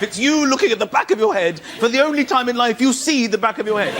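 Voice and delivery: normal talking voice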